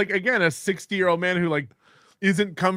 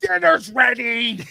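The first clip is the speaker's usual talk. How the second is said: Opie family voice, calling out; calling kids to dinner; 7 semitones higher, 4 dB louder, slower than usual. Retold or imitated. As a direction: yell dinner's ready like Opie's mom. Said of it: "Opie's entire family talks like Opie does".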